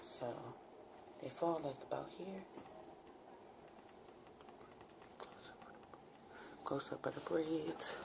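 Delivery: soft hushed whisper. Real, not acted. Whispering close-up near sleeping baby.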